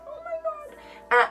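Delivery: high, slow stunned oh my God; shocked gasp. Retold or imitated. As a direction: gasp it out as the phone rings. Ex finally calls back.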